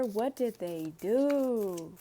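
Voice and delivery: ordinary delivery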